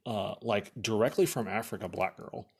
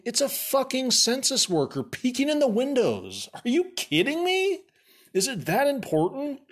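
much higher, louder disbelief; incredulous outrage; 11 semitones higher, 4 dB louder than usual. Real, not acted. Finding another census worker at the windows, in high incredulous outrage. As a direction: explode in disbelief at the absurdity.

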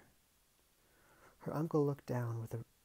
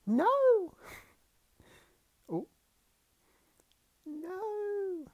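high cry; 7 dB louder, much slower than usual. Acted. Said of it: "The quiet cry".

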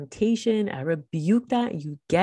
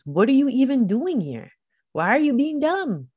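higher, louder rebuke of the devil; firm rebuke; 7 semitones higher, 5 dB louder than usual. Shown as a demonstration. Preacher showing how to rebuke the devil, loud and firm. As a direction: confront the devil head on. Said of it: "That's how you have to pray".

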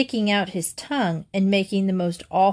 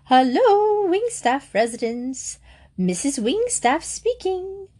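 high swooping sing-song hello; very high sing-song phone greeting; 8 semitones higher than usual. A narrator's reading. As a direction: lilt the greeting up and down. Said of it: "answered in a sing-song voice".

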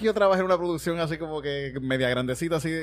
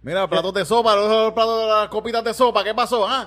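mock yelling boss; 5 semitones higher, 5 dB louder, slower than usual. Restaurant boss yelling about plates.

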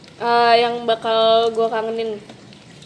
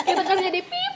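normal talking voice

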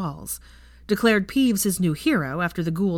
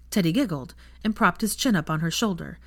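plain reading voice

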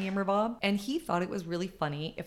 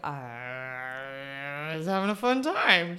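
sheepish excuse; 4 semitones lower, much slower than usual. Retold or imitated. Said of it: "I was like".

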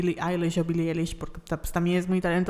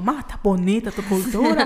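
mock Yucatecan accent; 2 semitones higher, 4 dB louder than usual. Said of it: "cuando lo hacen es como".

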